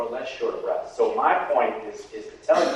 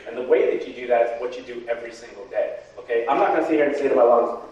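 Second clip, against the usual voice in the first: normal talking voice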